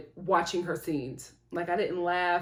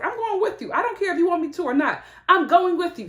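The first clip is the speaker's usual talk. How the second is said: high, firm, quick insistence; insistent daughter; 9 semitones higher, 5 dB louder, faster than usual. Retold or imitated. Daughter telling her mom she's coming along, insistent. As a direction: put your foot down, pitched up.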